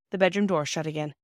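usual speaking voice

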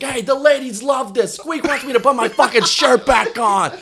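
high, rowdy mock showboating; imitated loudmouth rallying his audience; boastful jail dude. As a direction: call out to the whole room, voice pushed up. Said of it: "he's like".

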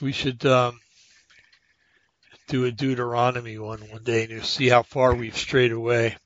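ordinary talk

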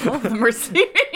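laughing through the words; breaking up while talking. Real, not acted. Laughs at sleepy audience.

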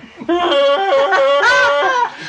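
high mock maniacal laugh; Joker-gassed laugh. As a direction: imitate someone sprayed with Joker gas. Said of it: "And he was like that".

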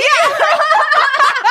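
a loud, high shriek of laughter. Real, not acted. Cohost cracks up at Buffy joke.

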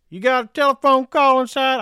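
loud, high-pitched yelled call; imitated yell. Movie cook hollering to the bar, yelling. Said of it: "who comes out and yells".

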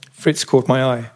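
plain reading voice

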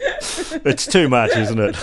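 laughing. Real, not acted. Cracking up.

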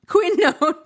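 loud, high, laughing outburst; overemphasized qui. Real, not acted. Reader exasperated with her stumbles, loud laughing emphasis. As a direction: blurt it out loud through laughter. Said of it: "emphasize qui so bad".

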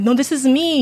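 high-pitched, desperate insistence; crazy person insisting. Retold or imitated. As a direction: plead it like someone nobody believes. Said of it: "sound like the crazy person saying".

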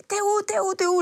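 high, urgent repeated where-are-you; panicked calling out. Retold or imitated. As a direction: call out in a rush of worry, voice jumping up. Colleague panicking on the phone.

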